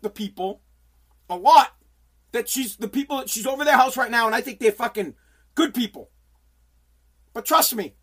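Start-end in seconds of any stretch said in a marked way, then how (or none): none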